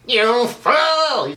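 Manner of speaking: high-pitched cartoon villain scream; screaming rage. Shown as a demonstration. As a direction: cry it out in theatrical villain rage. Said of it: "he's screaming".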